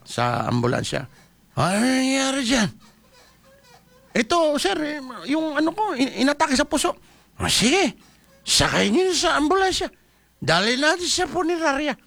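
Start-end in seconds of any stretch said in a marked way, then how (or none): 1.6-12.0 lightly voiced comic skit between ambulance driver and official; acted sample dialogue. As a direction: play out a quick roadside exchange. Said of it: "parang sinample lang ko lang siya".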